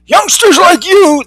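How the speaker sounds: put-on high, slow old-timer's scolding. Old-timer scolding young Eugene, high and slow. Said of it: "the old-timer said".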